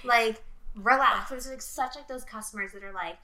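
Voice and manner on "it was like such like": ordinary talk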